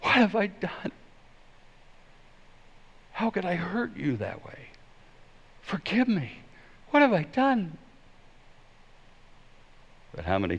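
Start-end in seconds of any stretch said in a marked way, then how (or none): 0.0-7.8 high, soft, stricken plea; plead, horrified at the hurt you caused; anguished remorse. Shown as a demonstration. Preacher shows one meaning of the phrase, anguished remorse. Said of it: "if you change the inflection".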